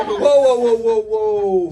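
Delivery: loud, drawn-out whoa, slow; stopping himself. Real, not acted. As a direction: rein the story in with slow loud whoas. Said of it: "hold on".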